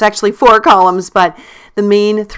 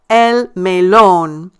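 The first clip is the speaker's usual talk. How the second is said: "el melón" very slowly, long stressed LÓN; stressed syllable; much slower than usual. Shown as a demonstration. Teacher showing the underlined syllable, slow stressed "LÓN" in "melón". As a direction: stretch out "el melón", leaning on the final LÓN. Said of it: "notice I stress the syllable".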